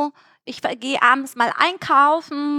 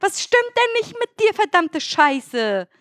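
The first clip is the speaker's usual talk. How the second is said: retold angry rant; 7 semitones higher than usual. To her fiancé at his video game, retold exasperated telling-off in a higher voice. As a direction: scold him in a raised, exasperated voice. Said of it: "ich hab zu ihm gesagt".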